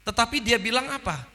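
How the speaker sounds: ordinary talk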